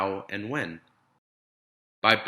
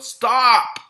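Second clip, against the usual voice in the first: a sharp, much higher, loud shout; yelled stop; 12 semitones higher, 11 dB louder than usual. Acted. Parents yelling at Ada to end her stinky experiment, loud shout. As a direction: slam the brakes on the chaos. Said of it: "but her parents yelled".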